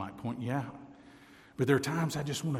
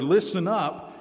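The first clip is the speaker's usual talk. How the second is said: "listen up" hit higher and louder; "listen up" driven up high and loud; emphasis on listen up; 7 semitones higher, 5 dB louder than usual. Shown as a demonstration. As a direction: punch "listen up" hard. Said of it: "the word that gets the emphasis".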